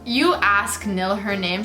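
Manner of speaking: usual speaking voice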